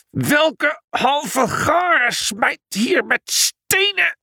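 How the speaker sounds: traag, hoog gebrul van een woedend nijlpaard; brullend nijlpaard. A narrator's reading. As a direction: brul langgerekt als een kwaad dier. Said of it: "met een enorme brul". (woedende, hoge nijlpaardbrul)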